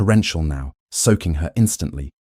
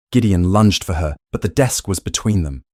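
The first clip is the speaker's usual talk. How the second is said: ordinary delivery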